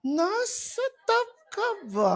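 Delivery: high, drawn-out teasing question; playful prompted question. Shown as a demonstration. Telling the audience what to ask their seatmate, playful. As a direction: stretch it out high, ending in a laugh. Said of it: "Sabihin mo".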